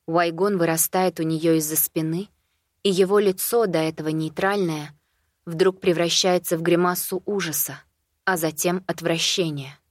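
ordinary delivery, nothing marked

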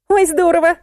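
high-pitched happy exclamation; delighted. A narrator's reading. Musya hears the timer is set, delighted. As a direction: rejoice in a high voice. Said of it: "обрадовалась Муся".

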